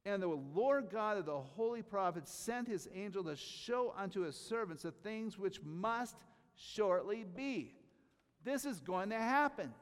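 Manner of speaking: high, loud, ringing proclamation; full-force preaching. Acted. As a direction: preach it out to the whole room. Reads scripture, then climaxes.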